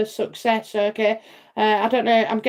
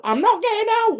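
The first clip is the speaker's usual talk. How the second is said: much higher, squeakier repeat of NOT; higher whine; 11 semitones higher than usual. Retold or imitated. Refusing to leave the car, much higher whine. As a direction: push the pitch way up on the second refusal. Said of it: "I'd be like".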